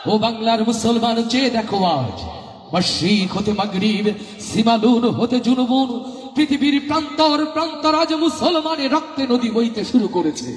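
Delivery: high, fervent oration; impassioned preaching. Acted. Preacher exhorting the Muslims of Bengal, fervent and high. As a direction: rouse the listeners with rising fervour.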